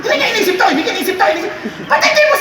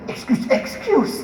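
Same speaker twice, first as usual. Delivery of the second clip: slow whisper; whispered excuse; 4 semitones lower, 4 dB quieter, slower than usual. Pretend. Latecomer whispering as he squeezes past seated people.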